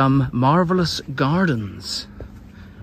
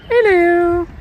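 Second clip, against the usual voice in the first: high cooing hello; 5 dB louder than usual. Real, not acted. Greeting a bird.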